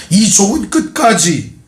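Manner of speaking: normal talking voice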